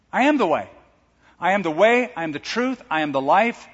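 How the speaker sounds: proclaiming. Acted. Preacher proclaims Jesus' words.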